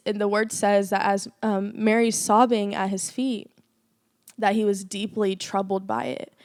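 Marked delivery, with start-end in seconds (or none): none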